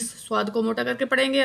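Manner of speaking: ordinary talk, nothing marked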